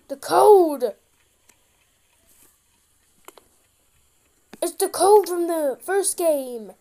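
loud excited exclamation. Real, not acted. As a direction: blurt it out, thrilled. Recognises the code excitedly.

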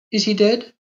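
lightly voiced frightened Carlotta; frightened young girl. A narrator's reading. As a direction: she dreads the answer. Girl asks if he died.

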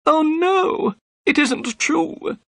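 very high, drawn-out wail; distressed cry. A narrator's reading. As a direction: protest in a frightened, high-pitched voice. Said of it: "cried Thaddeus Sholto".

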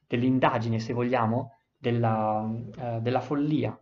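normal talking voice

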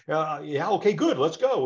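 acted agent, eager. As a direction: play the agent jumping in. Agent misreads client's news.

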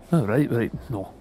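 usual speaking voice

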